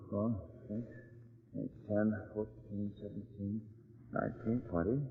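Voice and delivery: soft murmured counting; counting under breath. Acted. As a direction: count quietly to yourself. Counting windows under his breath.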